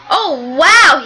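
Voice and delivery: gasping oh wow; gasped. Acted. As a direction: gasp it out in surprise. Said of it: "he gasped".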